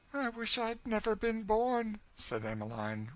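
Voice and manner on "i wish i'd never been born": put-on very high, despairing girl's voice. A narrator's reading. Girl wishing herself unborn, high despair. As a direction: wail it mildly, pitched far up. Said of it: "wringing her hands".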